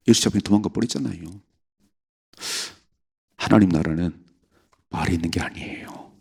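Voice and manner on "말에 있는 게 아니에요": whisper. Shown as a demonstration. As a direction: whisper it softer, unvoiced. Repeats line as whisper. (속삭이듯 조용히)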